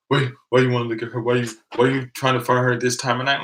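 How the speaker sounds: quick, pushy questioning, lightly acted; imitated interrogation. Policeman questions him about his friend, mock suspicious.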